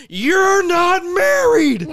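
high, slow, drawn-out yell; mock accusing shout. Imitating the villain exposing Kevin, hammed-up shout. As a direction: belt out the big accusation as the villain. Acted villain's dramatic reveal in retelling.

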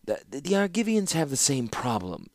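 high hesitant stutter; stammering. A narrator's reading. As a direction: trip over the first word like a timid envoy. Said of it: "stammered the Sensual".

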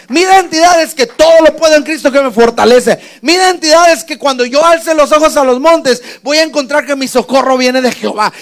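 loud, high, rousing declaration; shouted pulpit declaration, relentless and high; full-force preaching. Real, not acted. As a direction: proclaim it to the congregation, building in force.

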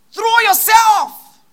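slow, high, loud shout; shouted exhortation. Real, not acted. Preacher urging the congregation, slow loud shout. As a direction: hurl it at the congregation.

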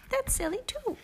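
very high small voice; small child voice. Acted. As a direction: answer like a sulky toddler. Pookie answers.

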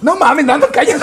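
laughing protest. Real, not acted. Teasing friend's opinion.

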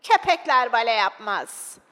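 loud, slow, disapproving call; acted shout. Spectator in the back rows heckling the dog, shouting. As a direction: heckle the stage in a put-on voice. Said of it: "diye bağırdı".

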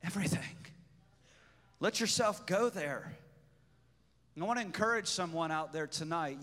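quiet, intimate pastoral voice; soft encouragement. Acted. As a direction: speak gently to one listener.

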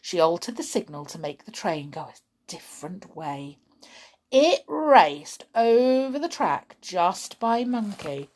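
ordinary delivery

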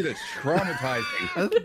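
high, slow, loud shocked cry; shocked outburst. Real, not acted. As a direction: gasp it out in mock horror. Reacting to stillborn story.

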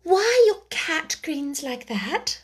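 put-on high, slow, hesitant girl; timid girl. A narrator's reading. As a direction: ask shyly. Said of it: "said Alice a little timidly".

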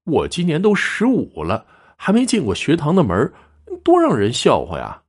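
high-pitched youthful grumble; grumbling. A narrator's reading. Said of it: "刘庆生嘟囔道".

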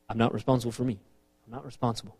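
low, very quiet disclaimer; small, soft, acted excuse; mock blame-dodging. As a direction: shrug it off under your breath. Voicing someone dodging blame.

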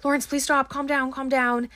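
mock panic, begging him to calm down; retold panicky plea. Adam begging Lawrence to stop, a hint of panicky pleading. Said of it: "getting panicky".

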